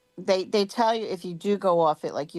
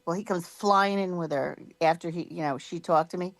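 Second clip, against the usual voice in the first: ordinary talk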